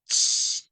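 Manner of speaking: "Ch" as a voiceless ch sound alone, no vowel; just the hiss of "Ch", like in chico; ch sound demo. Shown as a demonstration. Teacher isolating the che sound, voiceless. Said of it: "este es el sonido de la che".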